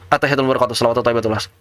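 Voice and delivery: low, hurried mumbled prayer; rushed recitation. Shown as a demonstration. Teacher showing how people speed through prayer, rushed recitation. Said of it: "Jangan dibiasakan ngebut".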